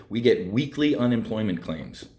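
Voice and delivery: ordinary talk, nothing marked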